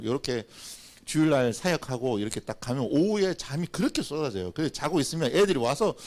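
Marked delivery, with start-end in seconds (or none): none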